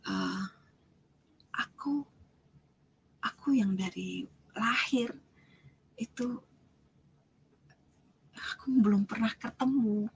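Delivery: halting, with long pauses between phrases; slow, mildly choked-up quote; retold tearful speech. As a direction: imitate someone struggling to speak through tears. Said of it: "Begitu dia nangis, dia bilang".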